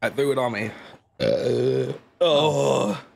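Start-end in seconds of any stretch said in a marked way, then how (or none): none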